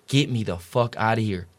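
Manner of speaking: voiced mock scream at ordinary volume; retold scream. Patient pleading with his dad to get him out, retold scream. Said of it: "and I'm screaming".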